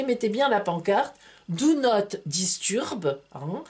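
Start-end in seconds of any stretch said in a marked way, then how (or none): 1.5-3.2 put-on slow, deliberate English with a southern French accent. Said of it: "c'est l'accent anglais, avec le sud de la France".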